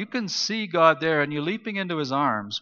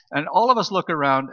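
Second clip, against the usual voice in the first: ordinary delivery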